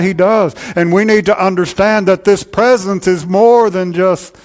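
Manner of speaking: loud, high, driving preacher's voice; full-force preaching. Acted. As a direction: proclaim it over the congregation.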